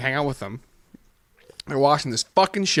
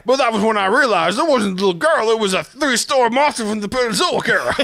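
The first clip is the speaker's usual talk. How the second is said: loud, high cartoon storyteller; mock dramatic reveal; 8 semitones higher, 4 dB louder than usual. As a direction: build the monster reveal with hammed-up alarm. Dramatic reveal in South Park bit.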